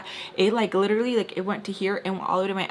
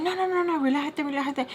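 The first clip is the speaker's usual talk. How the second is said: placating; 6 semitones higher than usual. Retold or imitated. Retold man placating her in panic.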